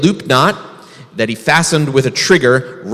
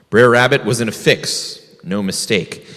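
usual speaking voice

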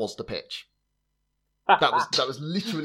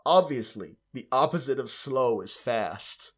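put-on terrible American accent; slower than usual. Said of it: "I'm gonna do a terrible American accent for it".

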